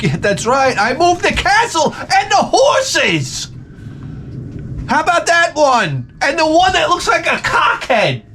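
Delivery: high, drawn-out mock gloating yell; hammed-up victory taunt. Son taunts the auditorium after winning at chess, hammed-up gloating. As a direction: crow it way up high, stretching the words.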